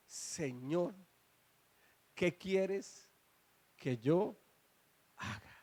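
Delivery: imitación de súplica temerosa, baja y entrecortada; temeroso, imitando. Retold or imitated. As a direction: dilo casi sin voz, con pausas de temor reverente. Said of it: "temblando y temeroso a Jesús". (súplica temerosa y contenida, imitada)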